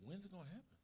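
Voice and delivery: high, soft doubtful asking; doubting question. Retold or imitated. Preacher acting a doubter asking a brother. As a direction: ask it like a doubter. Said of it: "I say".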